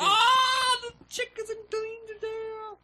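very high, slow cartoon voice; dinosaur voice. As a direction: squeak it out like a cartoon creature. Sudden put-on voice.

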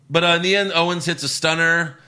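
ordinary talk

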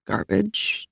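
plain reading voice

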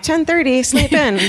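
light, incredulous objection; mock indignation. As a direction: protest it in a high, joking voice. Mock reaction to early housekeeping.

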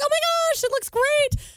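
high, excited gushing. Retold or imitated. Family gushing over flower girl dresses, high and excited. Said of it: "we're all like".

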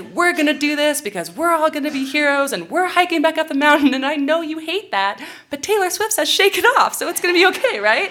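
high, loud pep talk shouted over the storm; rally the crying kids, loud and high, forcing cheer; loud rallying call. Retold or imitated. Leader to her crying students in the storm: loud high rallying shout.

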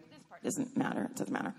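whispered throwaway aside; whispering aside. Real, not acted. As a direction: hush it, just between us. Aside to audience.

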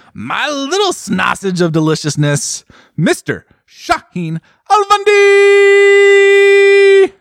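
builds to a huge, high, drawn-out name yell; grand announcer intro. Pretend. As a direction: announce him like a boxing champ.

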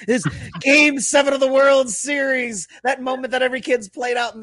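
hyped-up build. Real, not acted. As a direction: build the drama of game seven. Hyping an imagined championship moment.

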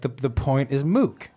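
"mook" said slowly and firmly in place of moot; friend's mangled saying. Retold or imitated. Friend confidently mispronouncing moot as mook. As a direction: mimic the friend insisting "The point is mook", slow. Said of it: "But she would be like".

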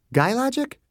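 amused questioning; Margaret, wry. A narrator's reading. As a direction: tease the phrase Guy logic with a lift.

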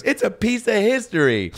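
high, indignant imitation; hammed-up offended insistence; mock upset wife. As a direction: protest it in a high, put-on voice. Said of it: "got, like, really upset".

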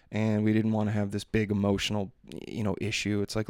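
ordinary talk

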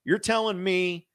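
mock fan realising big news; excited double take. Fan reacts to the tweet with excited disbelief. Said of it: "because it's like".

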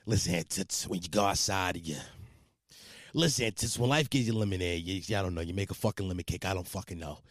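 low, soft Irish-Italian tough guy. Acted. Host redoing the funny phone bit, Irish-Italian voice. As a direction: mumble low in a comic Irish-Italian accent. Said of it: "Irish Italian voice".

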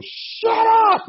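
very high, loud yell; loud heel shout. Retold or imitated. Rookie in the ring trying to heel out, loud shout. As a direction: yell it like a wrestling villain. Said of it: "Like, loud, you know".

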